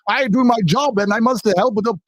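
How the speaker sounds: high, badly faked German accent. Imagined police officer waking up, half-hearted German accent. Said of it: "can't do a German accent".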